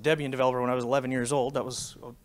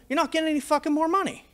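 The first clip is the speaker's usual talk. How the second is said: fast and much higher, biting off each word; retold heated outburst, quick and high; mock angry refusal; 12 semitones higher, faster than usual. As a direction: rattle it off like a furious kid standing his ground. Quoting himself refusing the vendor.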